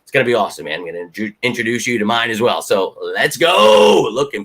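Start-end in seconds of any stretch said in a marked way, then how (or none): 3.1-4.1 slow, loud drawn-out cheer; rally the fan family; hyped shout. Real, not acted. Vlogger pumps up a viewer's family, slow loud shout.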